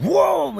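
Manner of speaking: loud, high engine whoom; imitated bike roar. Telling how the bike took off, imitated roar. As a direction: mimic a bike suddenly opening up. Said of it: "them bikes scream".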